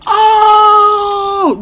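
high, loud shocked "Oh!"; arena gasping at a slap; crowd scream. Retold or imitated. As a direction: yell it like a stunned crowd. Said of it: "I screamed".

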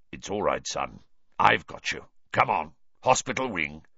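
calm him down; low reassuring man. A narrator's reading. Stranger reassuring Harry.

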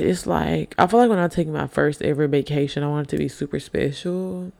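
ordinary talk, nothing marked

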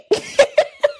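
very high, squealing laugh; high laugh of disbelief; incredulous laugh. Real, not acted. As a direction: crack up in a high pitch. Laughs at the retold advice.

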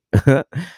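quick amused laugh; a bright little chuckle; short laugh. Real, not acted. Laughs at own joke.

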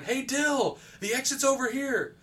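high-pitched imitation of a friend calling out; Eddie's voice. Monster mimicking a dead friend lures a player, high calling voice. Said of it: "using Eddie's voice going".

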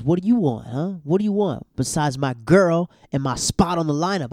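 loud aggressive mock taunt at Luke; Nathan's challenge. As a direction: get in his face about the girl. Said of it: "And he goes".

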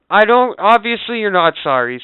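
retold angry comeback, high and loud; acted-out irritated customer; angry retort. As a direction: cut off the apology, indignant. Retells arguing with the agent.

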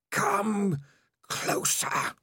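high then whispered lure; hissing whisper. Acted. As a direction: pull him in with a whisper. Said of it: "voice seemed to pull at him".

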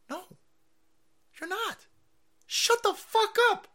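exasperated, very high voice; high-pitched angry retort. Real, not acted. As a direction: push it up high and stretch it out in disgust. Retort to the mocked victims.

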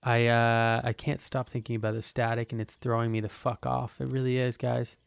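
ordinary delivery

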